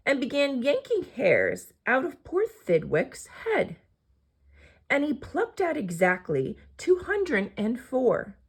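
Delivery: ordinary delivery, nothing marked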